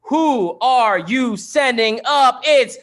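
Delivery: high, loud announcer call; hype host shout. Real, not acted. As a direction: pump up the crowd. Host hypes final round.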